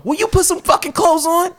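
very high-pitched exasperated question; comic high-voiced pleading protest; mock exasperated demand. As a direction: act out losing patience, incredulous. Retells his side of the stalemate.

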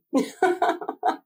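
high laugh with little voice; real high-pitched laugh. Real, not acted. Co-host laughing after asking about sacking the coach.

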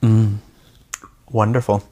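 right after a sip of soda; swallow, then savor it; while sipping a drink. Real, not acted. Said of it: "Excuse me while I sip my delicious Secto Cooler".

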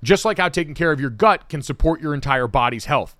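upbeat, punchy sponsor read; podcast host's energetic promo delivery. Acted. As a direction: sell it briskly and clearly, with emphatic lift.

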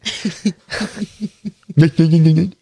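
laughing through hiccups. Real, not acted. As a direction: giggle broken by hiccups. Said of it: "Don't laugh at my hiccups".

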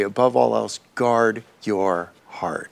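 slow, soft solemn reading; quiet reverent verse; scripture quote. Acted. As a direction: lay the verse down gently and slowly. Quoting Proverbs solemnly.

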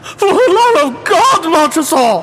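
desperate cry. A narrator's reading. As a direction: scream to be let out. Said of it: "to those words I hearkened in vain".

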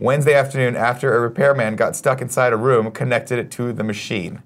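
normal talking voice

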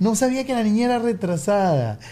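high-pitched imitation of the lady; tactless lady. Father showing how the lady said it, high-voiced imitation. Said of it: "Pero me lo dijo así".

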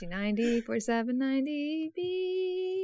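stretched-out letters climbing in pitch; drawn-out rising delivery. Shown as a demonstration. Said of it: "That's a little different".

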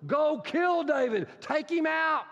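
enraged shout; furious royal command. Acted. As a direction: bark the order as the raging king. Saul commands Jonathan to kill.

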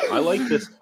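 laughs through the words; amused laugh breaking in. Real, not acted. Laughing at peach beer joke.

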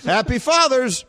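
high-pitched joking holiday send-off; mock cheery greeting. Host tossing a mangled holiday greeting to co-hosts before break, mock cheery.

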